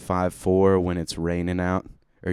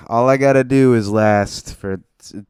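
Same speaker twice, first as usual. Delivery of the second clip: louder, hammed-up Southern twang; southern accent; 2 semitones higher, 7 dB louder than usual. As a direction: keep the Southern put-on going. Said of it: "in a southern accent".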